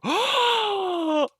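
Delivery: loud, high gasp of delight; big excited intake of breath; awed crowd gasp. Acted. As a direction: gasp like kids on Christmas. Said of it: "all the goblins go".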